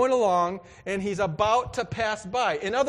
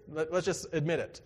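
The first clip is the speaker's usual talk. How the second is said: ordinary talk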